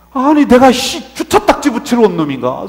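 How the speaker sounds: loud burst of pent-up indignation; inner resentment. Acted. Junior pastor silently raging over parking-ticket duty, indignant. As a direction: grumble it hard, as if only in your head. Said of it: "속으로 그냥 막 운분이".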